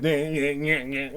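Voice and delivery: mumbled nyeh sounds mocking a kid's singing. Host imitating a kid's mumbled singing, mocking nyeh sounds. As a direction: nyeh it out like a kid mumbling a song. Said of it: "the kid".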